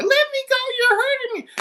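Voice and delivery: very high, loud squealed protest; high-pitched mock wail of a restrained child; struggling kid. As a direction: mimic a kid yelling to be released. Voicing struggling kid held back.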